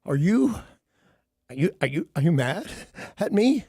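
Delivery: halting, stammered, rising questions; stuttering, hesitant imitation of the worried lady; imitating her timid voice. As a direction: mimic a nervous lady asking if he's mad. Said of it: "But she does this".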